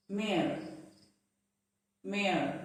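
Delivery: mayor as one syllable, like "mair"; mayor rhyming with air; correct pronunciation. Shown as a demonstration. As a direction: say mayor with the Y silent. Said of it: "but it is pronounced as".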